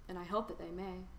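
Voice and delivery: ordinary delivery, nothing marked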